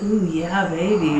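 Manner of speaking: low, soft, sultry; low sultry moan. Retold or imitated. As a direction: purr it low like a pleased lover. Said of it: "scooting it along like".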